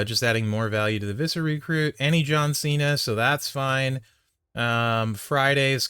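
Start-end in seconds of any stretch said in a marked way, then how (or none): none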